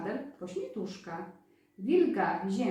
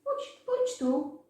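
quail calling; 10 semitones higher than usual. A narrator's reading. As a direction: coax like a little quail. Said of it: "Przepióreczka". (wysoki, wolny głos przepiórki)

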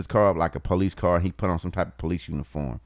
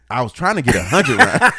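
laughing; 5 semitones higher, 9 dB louder than usual. Real, not acted. Cracks up admitting his purchase plan.

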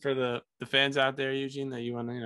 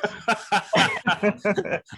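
loud high laugh; high burst of laughter; 9 semitones higher, 5 dB louder than usual. Real, not acted. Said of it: "That's racist".

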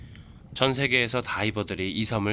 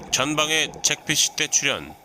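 squad orders; 6 semitones higher than usual. Pretend. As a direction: command the squad forward. Commander orders to divers.

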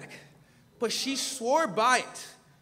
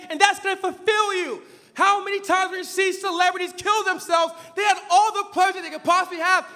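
fiery preaching; 8 semitones higher, 4 dB louder than usual. Acted. Preacher thunders at his congregation, then drops to a hush. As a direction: preach it hard from the pulpit, then let it drop. Preaching resumes after the story.

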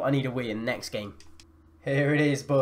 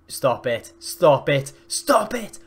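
rising panic; 4 dB louder, slower than usual. Real, not acted. Gamer urging his defence to stop the attack, increasingly panicked.

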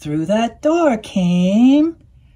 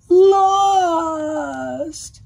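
quacked cry; 7 semitones higher than usual. A narrator's reading. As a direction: cry it out like a lost duck. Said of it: "quacked the duck".

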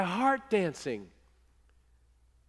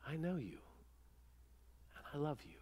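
very soft, tender voice of God; gentle God; 4 semitones lower, 18 dB quieter, much faster than usual. Acted. God speaking to the wounded heart, softly and gently. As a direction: murmur it close, barely above a breath. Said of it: "says gently".

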